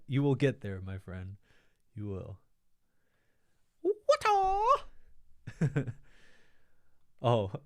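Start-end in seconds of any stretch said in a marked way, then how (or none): 3.8-6.0 very high, loud martial-arts yell; high-pitched drawn-out "wa-taa" battle cry; kung fu film cry. Shown as a demonstration. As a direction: squeal it like a kung fu film strike. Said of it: "I read it as Wata 'cause".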